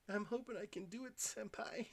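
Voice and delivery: put-on high anime girl voice. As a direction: coo it to your senpai like an anime fan. Anime voice addressing "Senpai.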